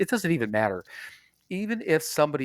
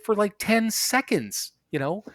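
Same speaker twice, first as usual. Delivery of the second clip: exasperated; 8 semitones higher than usual. Retold or imitated. Retold argument with exterminator.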